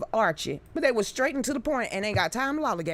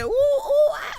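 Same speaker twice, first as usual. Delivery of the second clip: high loud screaming ooh; hammed-up imitation of a comic's scream; mock scream; 5 dB louder, slower than usual. Imitating the comic who screamed, high loud mock scream. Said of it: "The one that screamed".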